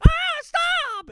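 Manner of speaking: loud, very high cartoon yelp; Mickey getting hit. Mouse being smacked by roommate, loud high yelp. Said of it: "clap into the mic".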